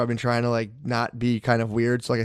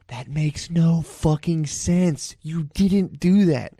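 slow, higher, drawn-out disbelief; exasperated retort; 5 semitones higher, much slower than usual. Retold or imitated. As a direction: spell it out slowly in exasperation. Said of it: "and you're just like".

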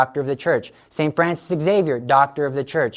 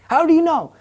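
astonished question; 12 semitones higher than usual. Retold or imitated. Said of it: "aide asks the Pope".

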